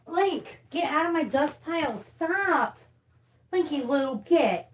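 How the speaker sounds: high-pitched scolding of the dog. Real, not acted. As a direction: shoo the dog away in a high voice. Shooing the dog from dust.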